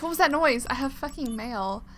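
ordinary talk

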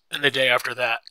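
loud exclamation, carrying on the outburst; loud, exclaimed complaint; crying out. A narrator's reading. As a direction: push it out loudly, question after question. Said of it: "Daisy cried".

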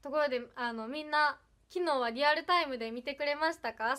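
ordinary delivery

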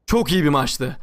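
low-pitched, drained after the excitement; trembling, spent. Real, not acted. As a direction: let the voice sink after an overwhelming match. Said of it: "Gerçekten titriyorum". (heyecandan titreyen, bitkin ses)

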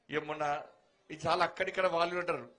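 ordinary talk, nothing marked

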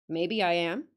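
put-on old northern guide, curt; Yorkshire accent. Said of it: "attempt the accent".

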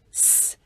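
"s" as a long voiceless hiss; isolated s sound. Shown as a demonstration. Teacher shows viewers the final sound, "s" hissed alone. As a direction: hold "s" as a pure hiss, no vowel. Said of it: "Phụ âm cuối chúng ta phát âm là".

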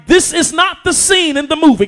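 loud pulpit line; first pass of the refrain; preaching. Acted. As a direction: set it up before the repeat. First take before repeat.

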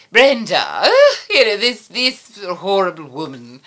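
sort-of-British haughty queen, loud swoop on the name; imperious queen voice, stretching out the disdain; Queen Shimmerine. Shown as a demonstration. Puppeteer demonstrates the queen voice for Brenda's name, imperious. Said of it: "start talking like that".

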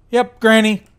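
sudden loud yelp; startled shout. Real, not acted. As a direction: jump and yell her name. Spotting the ghost granny.

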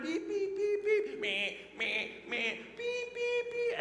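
high squeaky beep beep; imitated beeping. Dreaming of McDonald's timers, high mock beeping.

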